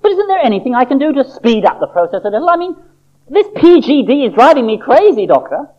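high, eager little-seed voice; an impatient seed begging to know; Sammy the seed. Acted. Sammy pleads again.

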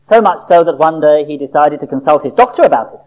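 usual speaking voice

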